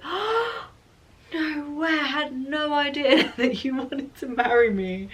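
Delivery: high, gasping pretend astonishment; hammed-up proposal reaction, pitched high; mock surprise. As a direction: feign total shock, breaking into a laugh.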